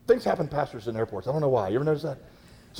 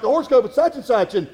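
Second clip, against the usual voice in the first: raised, high imitation of loud public chatter; mock older woman talking too loudly; loud older talker; 6 semitones higher, 5 dB louder than usual. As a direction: announce it to the whole waiting room. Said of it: "talking loud enough for people in China to hear them".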